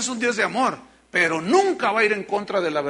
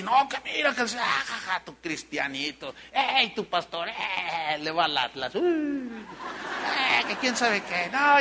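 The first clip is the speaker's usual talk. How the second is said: mocking scoffers; 4 dB quieter, slower than usual. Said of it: "Y tú estás ahí".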